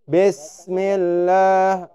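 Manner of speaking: "Bismillah" drawn out very slowly, recited; slow Quran chant of "Bismillah"; slow recitation. Shown as a demonstration. Teacher recites "Bismillah" for students. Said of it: "Banyak orang baca bes".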